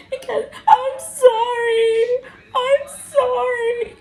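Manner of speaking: high, slow wailing sob; mock sobbing. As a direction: wail it like a crying drunk. Imitating Cassie vomiting, crying.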